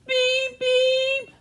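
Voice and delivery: high robot beep; squeaky robot beeping. Acted. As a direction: chirp it like a little robot.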